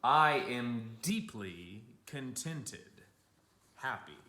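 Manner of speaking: slow, loud and contented; contented old artist. Retold or imitated. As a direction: savour every word like a happy old man. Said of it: "quoted Matisse speech".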